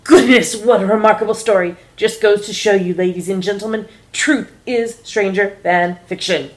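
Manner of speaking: loud, smug closing flourish; first officer closing the captain's talk to passengers, loud and smug; airline announcer. Acted. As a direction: wrap up grandly for the passengers.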